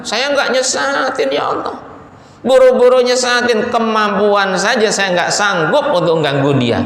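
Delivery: high-pitched, indignant Satan protesting innocence; protesting denial. Acted. Satan denying to God that he misleads anyone, high protesting denial. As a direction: deny it hotly, pitched well up. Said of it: "Ngelak dia".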